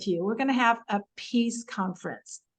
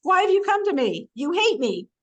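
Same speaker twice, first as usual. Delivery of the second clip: higher, louder indignant retort; blunt accusing Isaac; 7 semitones higher, 3 dB louder than usual. Retold or imitated. Isaac confronting the Philistine king, higher indignant tone. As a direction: throw it back at them, offended. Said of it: "this really non-diplomatic language".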